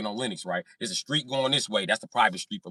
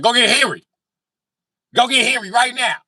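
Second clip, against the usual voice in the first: demanding; 12 semitones higher, 9 dB louder than usual. Retold or imitated. Demanding the mechanic loudly.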